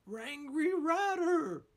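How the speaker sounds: Scooby-Doo voice. A narrator's reading. Scooby reads the villain's name, high frightened yelp. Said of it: "cried Scooby".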